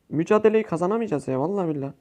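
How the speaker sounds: normal talking voice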